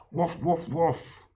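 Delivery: playful dog barks; barking. A narrator's reading. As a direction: bark like the dogs. Barking with children.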